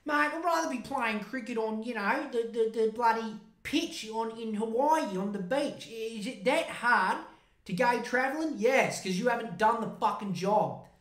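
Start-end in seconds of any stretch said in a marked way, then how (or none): 0.0-8.5 high, sneering mock Aussie bloke; whiny put-on voice, pitched high; mocking his mate. Mocking the mate who won't do his job, in a high whiny voice. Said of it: "He's like".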